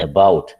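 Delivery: a-BOUT, punch the second syllable; teacher showing stress on the end of "About". Shown as a demonstration. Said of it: "second സിലബിളിനാണ് stress".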